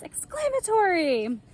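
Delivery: high, drawn-out exclamation; thrilled demo cry; excited exclamation. Shown as a demonstration. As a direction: exclaim it as if jumping up. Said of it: "like this".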